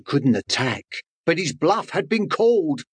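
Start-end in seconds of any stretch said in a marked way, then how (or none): none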